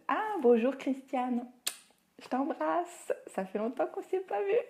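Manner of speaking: high, rising, delighted hello; delighted greeting. Real, not acted. Live host greets someone not seen for ages, delighted greeting. Spots an old acquaintance joining live.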